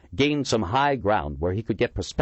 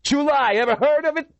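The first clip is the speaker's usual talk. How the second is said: high, loud furious challenge; enraged; 5 dB louder than usual. A narrator's reading. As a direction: throw it at him in rage. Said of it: "face distorted with rage".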